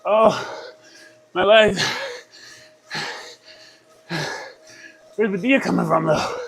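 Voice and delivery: straining mid-effort, breathless; cycling hard. Real, not acted. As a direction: push the words out under strain. Legs burning on the climb.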